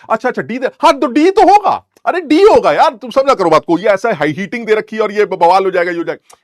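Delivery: high, loud mock-insistent backpedalling; flustered bluffing teacher. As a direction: bluff your way out loudly, acting cornered. Bluffing teacher defends switched answer. (हड़बड़ाए master की ऊँची, ज़ोरदार नकल)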